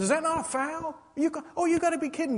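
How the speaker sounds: high-pitched exasperated complaint at the referees; retold protest at the refs. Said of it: "I said".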